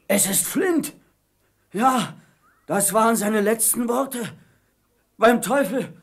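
terrified. Acted. Pirate terrified by ghost voice.

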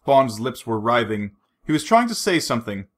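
ordinary delivery

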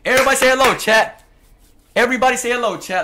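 loud, fast hype greeting; hype shout. Real, not acted. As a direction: hype up the stream.

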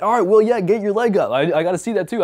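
eager and loud, pitched well up; excited camera guy. Retold or imitated. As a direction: hype him up like a pumped crew member. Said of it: "P K was like".

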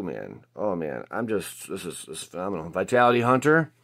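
normal talking voice

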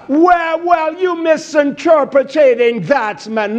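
hammed-up offended churchgoer; mocking objector. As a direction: mimic a whiny objector.

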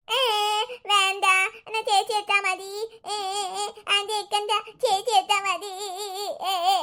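put-on whiny child crying in protest. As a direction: wail and refuse like a clingy toddler. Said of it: "അയ്യോ, കരയണ്ട". (കരഞ്ഞു ശാഠ്യം പിടിക്കുന്ന കുട്ടി)

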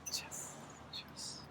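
slow breathy whisper. Real, not acted. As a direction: whisper it under your breath. Unprompted whisper at the grill.

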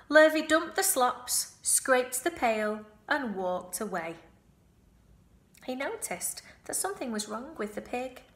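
plain reading voice

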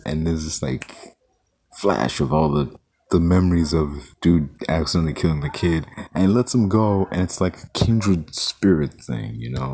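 ordinary delivery

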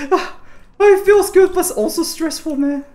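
high, loud and thrilled. Real, not acted. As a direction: gush with adrenaline. High excited after fight.

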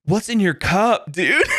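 high, slow, laid-back surfer dude. Retold or imitated. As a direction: ask it like a chill surfer. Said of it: "surfer guy".

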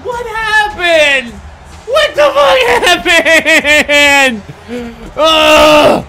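slow loud furious yell; raging gamer. Real, not acted. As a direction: bellow at the screen. Rage at game.

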